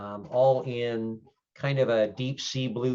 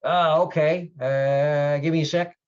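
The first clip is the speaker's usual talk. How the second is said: put-on louder, higher young clerk voice; raised, hesitant young-guy voice; young desk guy; 6 semitones higher, 9 dB louder than usual. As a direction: play a casual young guy at the front desk. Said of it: "this young guy, kinda shaggy, bobbed hair, goes".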